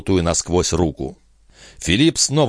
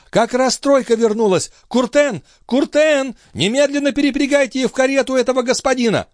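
very high, urgent calling; high-pitched hollering, hurrying at the end; shouted orders. A narrator's reading. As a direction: call out orders across the yard, high and pressing. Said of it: "вдруг крикнул смотритель".

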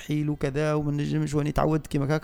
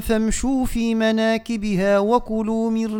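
slow, raised chanted recitation; Quran recitation; 7 semitones higher, 3 dB louder, much slower than usual. Shown as a demonstration. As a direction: intone the verse slowly. Recites next verse. (تلاوة مجودة بطيئة)

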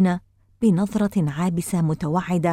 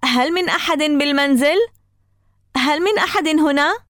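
high, raised calling voice; lifted voice, rising questioning call; loud call; 8 semitones higher, 4 dB louder than usual. A narrator's reading. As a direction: call out to whoever might be inside. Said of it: "نادينا معًا بصوت عال".

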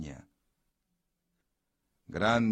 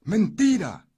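very high, loud denial; stone voice; 12 dB louder than usual. A narrator's reading. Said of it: "dijo la piedra".

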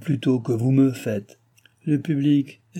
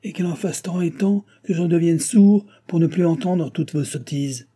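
shouting; 5 semitones higher, 4 dB louder than usual. Acted. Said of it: "cria le roi".

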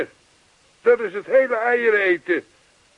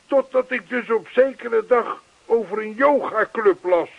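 usual speaking voice

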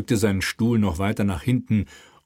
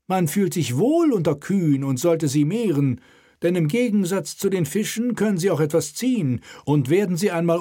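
raised, self-satisfied voice; smug inspector; 8 semitones higher than usual. Acted. Dutch inspector flatters the king, smug. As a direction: agree with oily satisfaction. Harlem lectures on cows.

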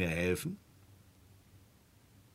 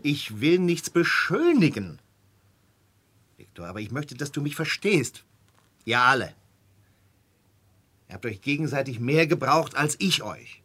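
heated plea; 5 semitones higher, 8 dB louder than usual. Acted. Walter pleading heatedly.

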